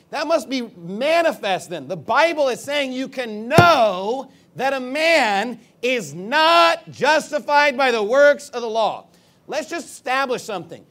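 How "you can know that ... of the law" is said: know and not drawn out, high and loud; emphatic preaching. Shown as a demonstration. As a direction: hammer know and not slowly at full voice. Preacher hammers the verse's point.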